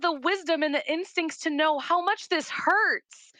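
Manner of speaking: high, emphatic disbelief; real amazement. Real, not acted. Therapist amazed by her five-year-old's wisdom, high and emphatic. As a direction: marvel out loud, pitch way up, stretching the end.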